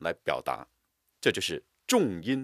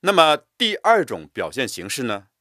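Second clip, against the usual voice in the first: normal talking voice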